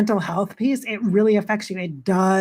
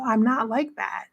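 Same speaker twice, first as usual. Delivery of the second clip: usual speaking voice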